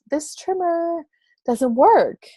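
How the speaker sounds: mock innocent complaint. Complaining to the service clerk.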